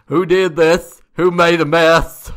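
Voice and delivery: hammed-up growling roar; grunting, growling janitor. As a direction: demand the culprit like an outraged oaf. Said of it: "he's grunting and growling".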